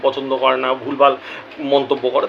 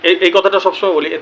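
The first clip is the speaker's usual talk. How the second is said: ordinary talk, nothing marked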